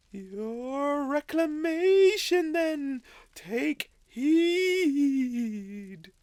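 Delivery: high, slow, otherworldly spirit; ghostly spirit voice. Acted. Ghost of Christmas Past solemnly summoning Scrooge, high and slow. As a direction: speak from beyond, high and drawn out.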